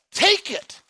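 high, loud call; exhortation. Acted. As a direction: push them to grab it.

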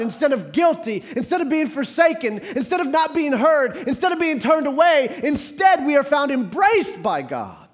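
prédication fervente, plus forte; impassioned preaching. Acted. Preaching at full force.

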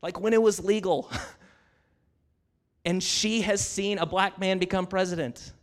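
ordinary talk, nothing marked

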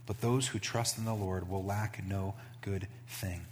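slow, soft reverent reading; a pastor reading a psalm slowly, quietly; scripture reading. Acted. Reads scripture aloud.

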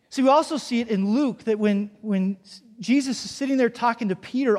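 usual speaking voice

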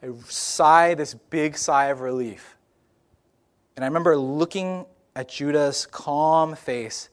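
normal talking voice

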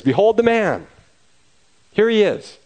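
high, loud presentation to a crowd; proclaiming. Acted. Pilate shows the beaten Jesus to the crowd, high loud proclamation. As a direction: announce him like a herald.

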